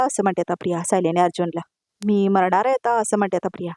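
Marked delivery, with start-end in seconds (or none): none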